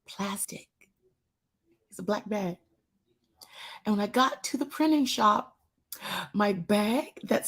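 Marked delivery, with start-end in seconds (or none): none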